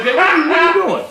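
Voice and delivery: high fast call of alarm; a quick high "hey, what are you doing". Retold or imitated. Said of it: "I said".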